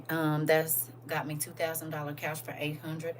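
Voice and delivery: ordinary delivery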